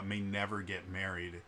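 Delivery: ordinary delivery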